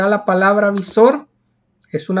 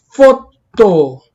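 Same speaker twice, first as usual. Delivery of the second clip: foto loud and split, with a strong FO; stress on first syllable; 7 dB louder than usual. Shown as a demonstration. Teacher giving foto as a grave example, stressed FO. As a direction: punch FO, then let -to fall in foto. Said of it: "es una palabra grave".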